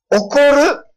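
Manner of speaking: put-on a small child afraid he'll get angry; little girl asking. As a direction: nervously ask it in a child's voice. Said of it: "必ず".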